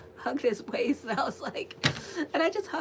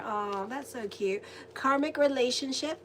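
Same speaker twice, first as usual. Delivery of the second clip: usual speaking voice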